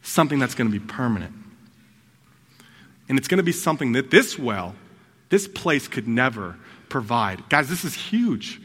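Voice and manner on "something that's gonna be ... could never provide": fast and lower, eager; fast low-voiced promise of forever; quick, low promise. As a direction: rush it out low and sure. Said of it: "He's like".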